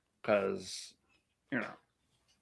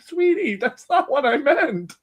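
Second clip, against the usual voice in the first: high, slow soothing voice; calm the child down gently; imitating a mother soothing her crying son, high and slow; mock soothing mom; 11 semitones higher than usual. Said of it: "she's like".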